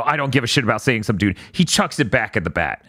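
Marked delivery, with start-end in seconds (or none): 0.0-1.4 rattled off quickly; quick recap. Retold or imitated. Retelling Denji's line in passing, quick recap. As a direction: toss the quote off fast, lower. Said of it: "after saying, you know".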